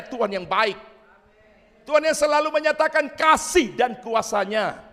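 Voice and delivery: high, loud, impassioned preacher; preaching at full force. Real, not acted. (khotbah berapi-api, lantang)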